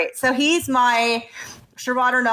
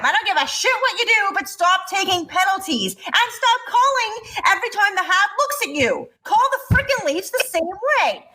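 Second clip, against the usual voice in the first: higher, driving rant to the end; rising exasperation; 7 semitones higher than usual. Acted. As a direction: hammer the point home, pitched up. Climax of the hockey rant.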